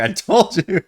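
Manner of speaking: high, smug I told you. Retold or imitated. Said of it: "I'm really gonna make Pi be like".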